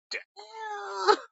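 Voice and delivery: soft, squealed, drawn-out cry; high-pitched dismay. Retold or imitated. Retelling shock at the hard chicken, high-pitched dismay. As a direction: let it squeal out in mock dismay.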